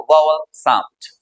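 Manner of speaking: "वावल" as VOW-ul, low and slow; slow, low "वावल sound" with an open "vow"; correct "vowel". Shown as a demonstration. Teacher models for students, "वावल" said slowly as VOW-ul. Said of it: "ऐसे बोलते हैं इसको".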